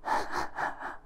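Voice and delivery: quiet breathy mocking chuckle; a cruel little laugh; soft chuckle. Impostor chuckling over helpless Twilight, quiet and mocking. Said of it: "It chuckled".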